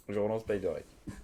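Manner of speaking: usual speaking voice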